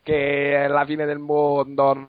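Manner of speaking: put-on Roman accent, ranting about wasted time; exaggerated Roman-accented complaint. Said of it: "fa il verso a Calcaterra facendo l'accento romano".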